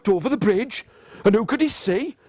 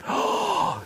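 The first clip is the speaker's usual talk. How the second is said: short high intake of breath; mock frightened gasp; 13 semitones higher, 3 dB quieter than usual. Reacting to the troll's challenge, mock frightened gasp. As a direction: gasp in pretend alarm.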